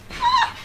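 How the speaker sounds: sudden shrill yelp of shame; high anguished cry. Retold or imitated. Recalling her shame, a high sudden cry.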